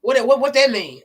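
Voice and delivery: a hammed-up challenging what that mean; mock aggressive man. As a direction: imitate a man pressing her aggressively. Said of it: "he was just very aggressive".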